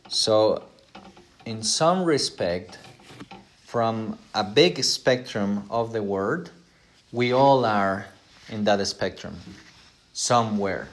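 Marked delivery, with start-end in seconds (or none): none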